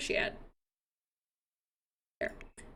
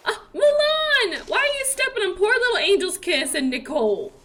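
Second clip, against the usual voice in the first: mock scolding; 6 semitones higher than usual. Real, not acted. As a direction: tell the goat off in a high, sing-song voice. Scolding a sim in game.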